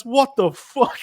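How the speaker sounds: shocked exclamation. Retold or imitated. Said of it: "and I went".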